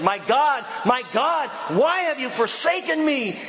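high, impassioned preached cry; Jesus crying out. A narrator's reading. Preacher voices Jesus forsaken on the cross, high cry. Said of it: "cried out with a loud voice".

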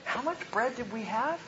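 high, soft, puzzled aside; hushed bewilderment. Acted. Apostle quietly asking the others about the bread, bewildered. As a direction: ask it small and high, secretly baffled.

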